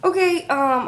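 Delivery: ordinary talk, nothing marked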